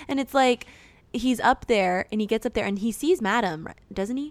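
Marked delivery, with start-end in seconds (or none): none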